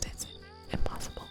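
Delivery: usual speaking voice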